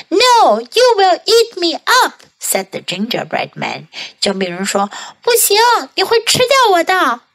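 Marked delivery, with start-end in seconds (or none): 0.1-2.1 high, loud frightened refusal; gingerbread man voice. Acted. 5.2-7.2 high, fast frightened refusal; gingerbread man voice. Acted.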